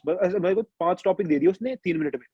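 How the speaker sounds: normal talking voice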